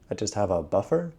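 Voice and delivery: ordinary talk, nothing marked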